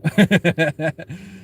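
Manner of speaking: short bursting laugh. Real, not acted. Telling viewers his prank, bursts out laughing. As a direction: cracks up mid-sentence.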